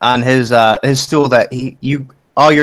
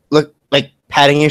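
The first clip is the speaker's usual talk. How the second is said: ordinary delivery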